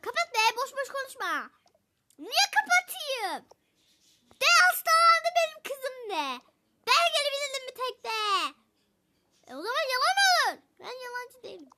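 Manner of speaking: high, loud squabbling with laughs; heated quarrel. Acted. Mother squabbling loudly on the phone, half laughing.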